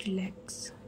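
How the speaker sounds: soft, hushed reassurance; calm her down gently; soothing whisper. Acted. Prerna hugs panicking Bhavya and soothes her in a whisper.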